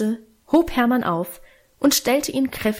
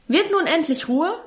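higher-pitched exasperated scolding; scolding father; 7 semitones higher than usual. A narrator's reading. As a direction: scold as a father at the end of patience. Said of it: "schalt Vater in erhöhter Lautstärke". (mit erhobener Stimme schimpfend)

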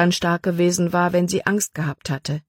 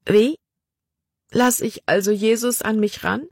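desperate, crying ask, pitched up; tearful plea; 5 semitones higher than usual. Acted. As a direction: let the long-held tears break into the question. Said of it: "Ein lange unterdrücktes Schluchzen brach aus Libby heraus".

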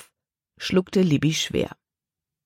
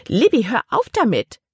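a sharp order, higher and louder; command; 7 semitones higher, 4 dB louder than usual. A narrator's reading. Aunt ordering her hiccuping niece to stop, higher and louder. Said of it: "befahl ihre Tante".

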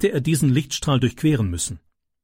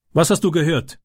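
higher-pitched, mildly challenging question; 5 semitones higher than usual. A narrator's reading. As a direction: ask it as a demand, pitched up a little. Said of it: "fragte Tyke herausfordernd".

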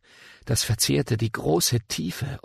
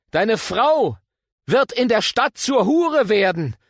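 prophetic denunciation; 9 semitones higher than usual. Acted. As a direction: thunder the judgement at Amasja. Amos hurls prophecy at the priest. (Prophet verkündet laut und hoch das Gericht)

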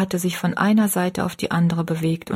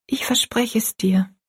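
put-on higher, half-whispered girl's promise; quiet promise; 4 semitones higher than usual. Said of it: "sagte Rosalie still".

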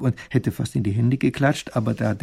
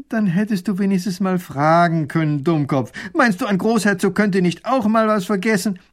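scolding duke; 7 semitones higher, 5 dB louder than usual. Acted.

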